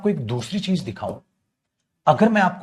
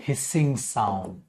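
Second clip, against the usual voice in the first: hissing drawn out very slowly; slow soft hissing sound; stretched out; 5 semitones lower, 5 dB quieter, much slower than usual. Shown as a demonstration. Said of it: "to avoid".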